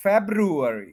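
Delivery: February as FEB-ru-er-ee, slowly; R variant. Shown as a demonstration. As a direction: sound the first r in February. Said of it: "वाई की जगह आप आर बोलें". (February, आर के साथ)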